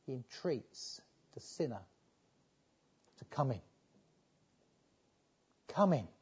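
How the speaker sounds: hushed whisper; softly whispered entreaty. Real, not acted. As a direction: lean in and whisper it. Preacher drops to a hushed aside.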